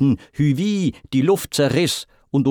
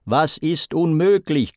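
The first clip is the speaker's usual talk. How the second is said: high, raised challenge; mild, higher-pitched shout; ringing call; 6 semitones higher than usual. A narrator's reading. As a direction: fling the question out. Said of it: "kam Poseidons Ruf geschwirrt".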